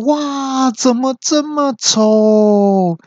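very high, long drawn-out wail; hammed-up shriek stretching the last word; horrified outcry. Friend seeing the face in the mirror, horrified. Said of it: "就大叫说".

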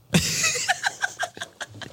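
high breathy rising giggle; long giggle. Real, not acted. Laughing at the agent's "are you done screenshotting", high giggle. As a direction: giggle helplessly.

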